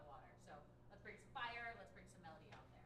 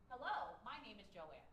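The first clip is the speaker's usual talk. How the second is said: varied melody; 7 dB louder than usual. Shown as a demonstration. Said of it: "to being able to find various melodies".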